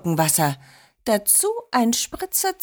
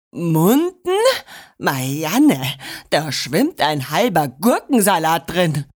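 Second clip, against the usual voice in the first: lower, outraged voice; indignant grandpa; 4 semitones lower than usual. A narrator's reading. Grandpa complains to Marianne about the tea, indignant. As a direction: protest in low, offended disbelief.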